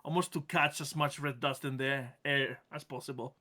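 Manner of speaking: ordinary talk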